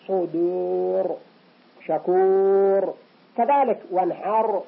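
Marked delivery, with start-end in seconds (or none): 0.1-2.9 صدور and شكور drawn out very slowly with a heavy R. Shown as a demonstration. Teacher repeats the heavy R slowly. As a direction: stretch both words with a thick R. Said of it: "فإن الراء سكن هكذا".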